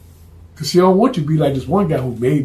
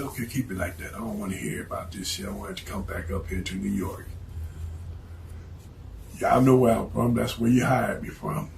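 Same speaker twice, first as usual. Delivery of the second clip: much softer, lower, dropping to a whisper; menacing hush; 6 semitones lower, 10 dB quieter than usual. Acted. The hitman warns the crew off in a low, menacing hush. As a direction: lean in and threaten them under the breath.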